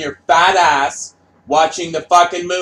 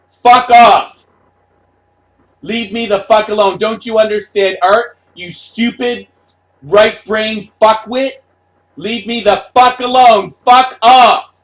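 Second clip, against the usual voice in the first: higher, much louder ranting; furious artist yelling; 6 semitones higher, 9 dB louder than usual. Retold or imitated. Artist screaming at a fan who doesn't get art, furious yelling. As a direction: scream the insults at the fans. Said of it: "That's what the artists are saying".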